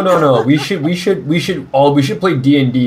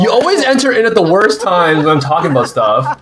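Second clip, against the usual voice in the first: ordinary delivery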